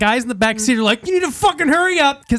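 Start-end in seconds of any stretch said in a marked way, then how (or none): 1.1-2.3 high-pitched panicky passenger; hammed-up high, frantic plea; urgent demand. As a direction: urge the driver on, worked up. Panicked passengers yell at driver.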